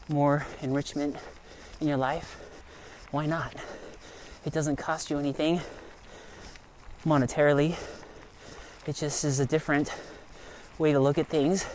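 normal talking voice

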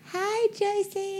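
very high, slow mouse voice; tiny squeaky critter. Shown as a demonstration. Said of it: "It's like".

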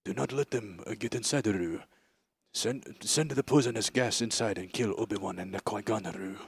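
imitating Gunray. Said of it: "where he's like".

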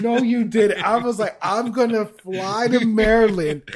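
loud excited disbelief; shout, wound up and laughing. Real, not acted.

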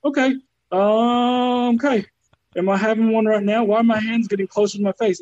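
a mock freak-out while high; stoned panic. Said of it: "stoned out of my goddamn mind thinking".

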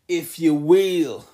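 slow, drawn-out, badly faked accent; Dusty Rhodes. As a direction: stretch it out in a horrible mock accent. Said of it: "That's a horrible Dusty Rhodes accent".

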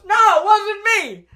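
very high, loud mock protest; indignant denial. Brother denying the card is his, loudly indignant. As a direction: squeal the denial like an accused kid.

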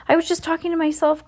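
very high, fast excuse; squeaking out a terrified plea of innocence; panicked cry. A narrator's reading. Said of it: "cried the witch".

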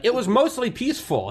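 high, sarcastic mock reporter voice. Mocking a reporter at a burning store, sarcastic. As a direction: sneer it like a clueless TV reporter. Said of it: "and it's like".

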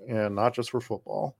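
ordinary talk, nothing marked

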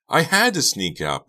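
high, loud retort; heated teen protest; snapping back. A narrator's reading. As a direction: snap defensively at mom. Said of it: "Becca snapped".

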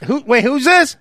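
put-on high, baffled voice of an old fan; confused old fan. Old fan answering a call from Ric Flair, high and confused. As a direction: act lost on the phone. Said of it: "I'll show you what it's probably like".

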